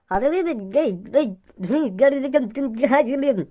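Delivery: slow, garbled babble; impaired speech. Acted. Man who has spoken this way since childhood answering, garbled babble. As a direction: slur every syllable into tongue-tied nonsense. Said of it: "यू तो बचपन से ही ऐसे बोले".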